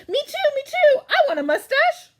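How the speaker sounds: high, loud, fast excited begging; excited plea for a mustache; eager kid. Acted. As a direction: bounce with childish eagerness.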